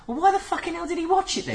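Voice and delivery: high, incredulous outburst; exasperated outburst. Retold or imitated. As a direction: throw up your hands in disbelief. Exasperated retold inner thought.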